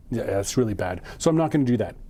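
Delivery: usual speaking voice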